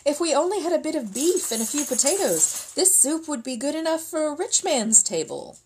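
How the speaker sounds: high, dreamy wishing voice; tempting soldier. Acted. Soldier tempting peasants with beef and potatoes, high and dreamy. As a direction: make them hungry for it.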